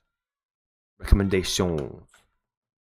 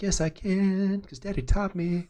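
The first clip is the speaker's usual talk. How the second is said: sassy little daughter; 13 semitones higher, slower than usual. Retold or imitated. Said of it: "She's like".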